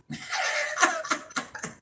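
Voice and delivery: a high, soft squeal of laughter. Real, not acted. Mother laughing at her thirty-year-old son, high squealing laugh.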